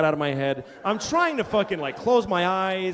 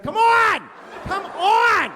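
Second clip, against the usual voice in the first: high, loud impatient shout; impatient yelling; 6 dB louder, much slower than usual. Retold or imitated. Wife yelling at her husband to finish, loud and impatient. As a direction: yell it at him to hurry up. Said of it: "Like, yelling".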